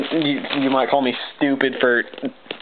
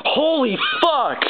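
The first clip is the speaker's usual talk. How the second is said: stretched-out high-pitched holy fuck; real disbelief as the card appears; drawn-out squeal of shock; shocked outburst; 14 semitones higher, slower than usual. Real, not acted. Rare card pulled, shocked outburst.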